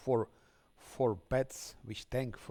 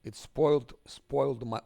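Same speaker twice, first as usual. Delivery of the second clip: loud frustrated outburst; 2 semitones higher, 8 dB louder than usual. Real, not acted. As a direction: blow up at the game. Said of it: "I'm pissed off".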